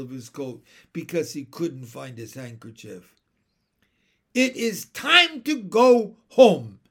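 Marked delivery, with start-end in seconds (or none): 4.3-6.8 high, very loud, slow order; trumpeting command. A narrator's reading. Mary Poppins ending the laughter, trumpeting command. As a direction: call out over a roomful of noise. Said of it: "voice sounded above the roars of laughter like a trumpet".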